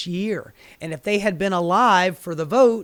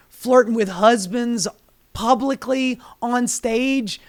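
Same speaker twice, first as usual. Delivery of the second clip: furious rant; 4 semitones higher, 4 dB louder than usual. Real, not acted. Building a tirade of insults at the widow, loud and high. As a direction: hammer out every insult.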